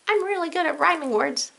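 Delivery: high character voice, pleased with itself; Cat in the Hat. Pretend. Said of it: "you are, Cat in the Hat".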